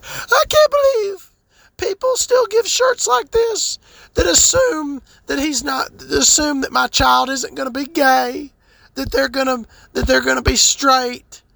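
high, far-off whiny mock of the dad; mocking crying dad. Mocking the crying dad on TikTok, whiny high voice. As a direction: mimic him in a shrill high weepy voice. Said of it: "And he's like".

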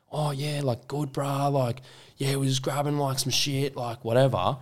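high, lazy bogan drawl; Aussie bogan voice. Retold or imitated. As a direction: play a rough Aussie lad. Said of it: "And they're like".

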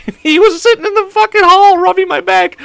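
very high, laughed-out words; barely holding together with laughter; laughing while talking. Real, not acted. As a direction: squeal it through real laughter. Cracking up at the memory.

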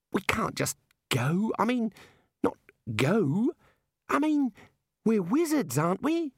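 halting, high-pitched appeal; flustered pleading. Acted. Young wizard appealing to fellow wizards, flustered and halting. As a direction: grope for words, voice climbing. Ponder pleads, fumbling for words.

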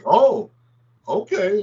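a boss thrown off balance, giving in; station manager surprised by the bold new hire; caught off guard. Retold or imitated.

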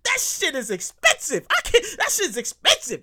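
laughing while talking. Real, not acted. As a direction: laugh through the line. Laughs at own rant.

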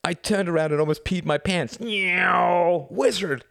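high-pitched gushing with a plane noise; excited boy. A narrator's reading. Boy general relives the plane attack, thrilled. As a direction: gush in a high voice, swooping on the noise.

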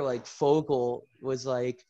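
ordinary delivery

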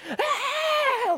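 mock shriek, very high, half laughing; hammed-up movie panic scream; panicked scream. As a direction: squeal like a movie extra in panic. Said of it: "It wasn't this".

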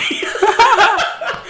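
a high burst of squealing laughter. Real, not acted. Friend cracking up at a tongue twister, high squealing laugh.

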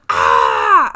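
sudden loud low yell as if startled in sleep; sleep-talk yell. Retold or imitated. Boyfriend yelling in his sleep at night, a loud low "Ah!". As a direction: shout out of a dream. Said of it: "he went".

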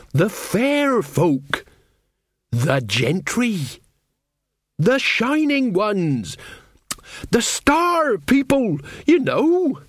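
very high, drawn-out euphemisms; heavy hinting. Acted. Mother hinting names to her baffled son, insistent. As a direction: stretch each name with rising insistence.

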